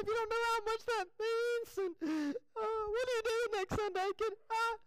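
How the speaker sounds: very high, tearful hammed-up voice; mock crying. Grateful person answering a prayer call, mock crying. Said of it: "if he can cry".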